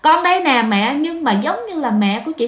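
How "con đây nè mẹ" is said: calling out to mother. Retold or imitated. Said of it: "cái chị mới kêu".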